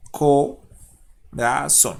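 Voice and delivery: "corazón" very slowly as co, ra, zón; syllable by syllable. Shown as a demonstration. Teacher dividing the verse, "corazón" in three slow separate syllables. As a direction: draw out each syllable of "corazón" with gaps. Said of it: "vamos acá a dividir".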